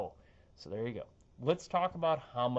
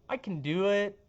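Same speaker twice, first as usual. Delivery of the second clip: normal talking voice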